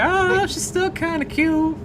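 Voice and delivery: high, light mock-cute voice; playful high-pitched reassurance; mock sweet voice. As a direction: defend her in a sugary high voice. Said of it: "it's like".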